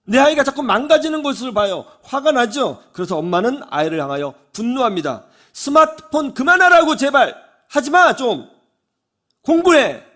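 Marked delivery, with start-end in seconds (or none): 5.5-10.0 loud, high exasperated scolding; angry scolding. Acted. Mother scolds her child glued to the phone, loud angry yell. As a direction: yell at the kid to put the phone down.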